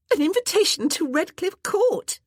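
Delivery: high, loud eager blurt. Acted. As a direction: burst out with it before anyone else can. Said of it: "Jessica blurted".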